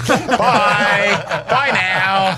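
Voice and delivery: high laughing bye; laughing goodbye. Real, not acted. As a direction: giggle out the goodbye. Laughing mock farewell.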